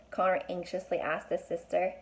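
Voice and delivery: ordinary delivery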